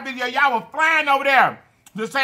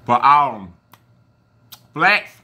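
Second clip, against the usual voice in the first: talking while licking fingers; words muffled by fingers; fingers at the lips; 9 semitones lower, 3 dB louder, much slower than usual. Real, not acted. Seen 3: No chewing visible; he repeatedly puts sauced fingers to his lips.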